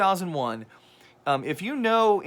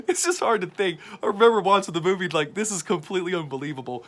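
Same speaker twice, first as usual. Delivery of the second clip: talking through real laughter, higher pitch; amused, laughing; 3 semitones higher, 3 dB louder than usual. Real, not acted. Film reviewer cracking up over Cage as a sorcerer, laughing. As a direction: fight down a laugh while speaking.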